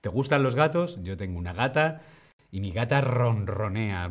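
ordinary talk, nothing marked

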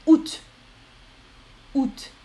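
août as one syllable with final T; août as "oot". Shown as a demonstration. Teacher shows commonest août, said "oot". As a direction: say août "oot", T clearly sounded. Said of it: "la prononciation la plus commune".

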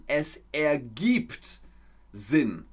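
"ergibt" drawn out slowly, then "Sinn" dropped; slow teacher demonstration of "Es ergibt Sinn"; careful model phrase. Shown as a demonstration. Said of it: "im Deutschen sagt man".

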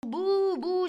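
high, loud jeering boos; mock booing. Shown as a demonstration. Teacher boos to show how people jeer. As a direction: boo like a hostile crowd. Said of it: "cris de dérision".

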